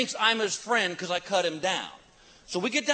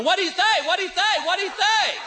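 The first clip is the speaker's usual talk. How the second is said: high, louder eager repeated questions; excited screaming; 10 semitones higher, 3 dB louder than usual. Retold or imitated. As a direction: pester in giddy excitement. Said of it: "They're screaming".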